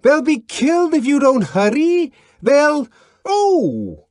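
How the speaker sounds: high, loud pleading shout cut off; panicked yell. A narrator's reading. Bernard yelling at the police before being shot. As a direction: shout in panic until hit.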